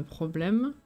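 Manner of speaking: ordinary delivery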